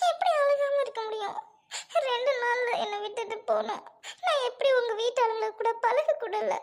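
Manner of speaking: pleading while crying. Acted. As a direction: let the tears break through the words. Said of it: "அழாத, அழாத".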